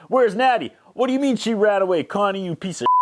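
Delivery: hammed-up furious father on the phone; angry dad. Dad phoning the hotel about his runaway daughter, mock rage. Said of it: "Dad calls and is like".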